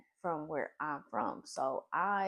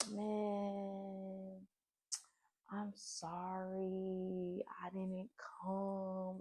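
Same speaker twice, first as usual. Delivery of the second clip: put-on soft, slow sweet-talking boyfriend; lightly voiced smooth apology, slow and soft; mock sweet-talking apology from jail; 7 dB quieter, much slower than usual. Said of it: "trying to be sweet talking me".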